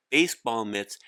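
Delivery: ordinary delivery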